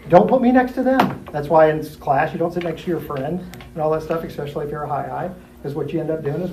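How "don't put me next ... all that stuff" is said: ordinary talk, nothing marked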